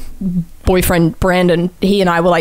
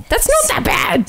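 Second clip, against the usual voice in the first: high squeaky reassurance; slower than usual. Real, not acted. Reacting to game name.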